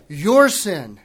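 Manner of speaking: "Your" punched loud, falling; stress on your. Shown as a demonstration. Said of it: "Let me say it again".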